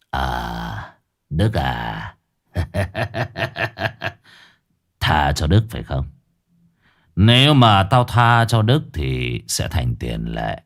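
low, slow menacing verdict; cold gang boss. A narrator's reading. Boss passes judgement.

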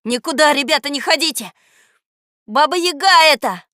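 high, loud urgent warning; alarmed shout. A narrator's reading. Boy warning friends about the witch, alarmed shout. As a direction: shout to stop them. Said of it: "закричал я".

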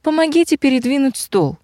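high-pitched request for help; calling out. A narrator's reading. Witch's daughter asking her husband for help, calling. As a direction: pitch it up, calling across the room. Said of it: "позвала она".

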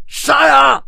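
high, very loud charging yell; drawn-out war shout; battle cry. A narrator's reading. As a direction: scream the order to charge. Players' battle cry in narration.

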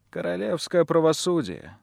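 ordinary delivery

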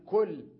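كل called out loud and high; loud example word. Shown as a demonstration. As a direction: punch out كل loudly. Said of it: "وأكل أقول". (نطق كل بصوت عال ومرتفع)

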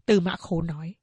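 plain reading voice

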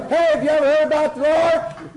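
loud, high, in-your-face greeting; overbearing street preacher yelling the question; pushy shouted witnessing. Shown as a demonstration. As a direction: bellow it right in his face. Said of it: "you talk just like this".

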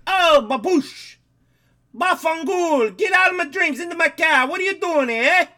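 Italian mobster shouting the song line. Acted. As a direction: belt it like a cartoon wiseguy. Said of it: "in a mobster voice".

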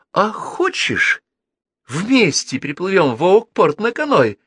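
put-on high, eager young girl; bright girl voice. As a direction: pitch it up like an excited girl. Girl proposes canoe trip excitedly.

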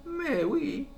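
chirpy concierge. Acted. Concierge answers the visitor in a high cheerful voice. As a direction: pipe up like a cheery southern concierge.

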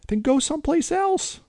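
put-on very high boy's voice; a frightened kid snapping back; scared kid retort. A narrator's reading. Boy telling the vampire to leave. Tommy scooted backwards.

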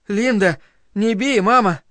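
high-pitched pleading; child's frightened plea. A narrator's reading. Boy being slapped by his mother, frightened plea.

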